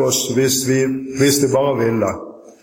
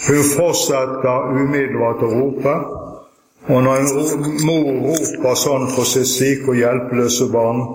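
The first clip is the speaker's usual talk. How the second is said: ordinary talk, nothing marked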